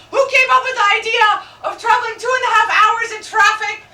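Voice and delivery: high, loud exasperated rant; indignant comic rant. Real, not acted. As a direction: rail against the cottage tradition. Ranting about cottage tradition.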